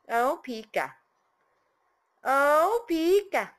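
loud, high gushing praise. Real, not acted. Owner praising her parrot, loud high gushing. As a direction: gush over the bird like a proud parent.

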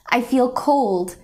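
ordinary delivery, nothing marked

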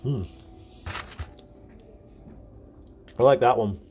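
chewing through cream filling; speaking with closed full mouth; mouth full of pastry. Real, not acted. Seen 2: Lips coated with cream, chewing after big bite, mouth full.

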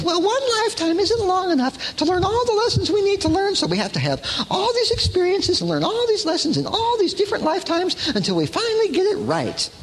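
high-pitched mock explanation; hammed-up high voice, spinning out the theory; Shirley MacLaine. Woman justifying reincarnation to listeners, high mock earnestness. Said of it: "Shirley MacLaine puts it that way".

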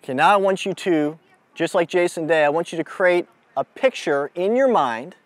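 ordinary talk, nothing marked

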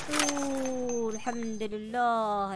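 slow low relieved breath; relieved sigh. Real, not acted. Player relieved after surviving, slow low sigh. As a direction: sigh it out slowly.

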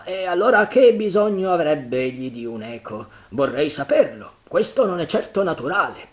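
loud, high, scoffing challenge; defiant pirate John. Acted. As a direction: shake off the fear and argue hard. John argues with frightened crew.

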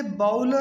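"बाउल" as baa-ul, ow glide; mispronunciation. Shown as a demonstration. Teacher showing the wrong way to say bowl. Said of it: "कहना गलत है".

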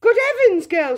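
high, loud shriek of alarm. A narrator's reading. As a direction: shriek like a horrified mother. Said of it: "shrieked Miss Beauregard".

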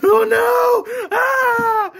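a very high, loud, shrieking cry of panic; panicked scream. Acted. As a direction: scream as if the walls are closing in on you. Son panics trapped in box.

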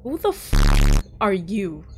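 loud, slowed, cut-off curse; outraged. Acted. Heroine snapping at the mailman, loud and cut off. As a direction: bite the swear off mid-word.

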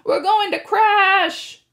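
high, loud, slow scared wail; panicked shout. A narrator's reading. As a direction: shriek it as you hurtle upward. Grover yelling in panic.

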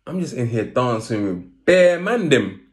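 high, loud comic surprise; mock outrage, no girls; comic disbelief. Retold thought while dancing.

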